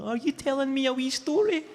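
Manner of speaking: high sing-song coo with a laugh; lightly laughing, coo it to the baby; baby talk. Retold or imitated. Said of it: "natural rapport when they speak to babies".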